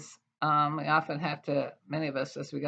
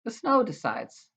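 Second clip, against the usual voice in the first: measured, expressive poetry reading voice; wry, deliberate poem recital; 7 dB louder than usual. Acted. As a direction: read it like a poet at a reading. Reads her title poem.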